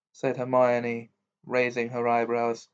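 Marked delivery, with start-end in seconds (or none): none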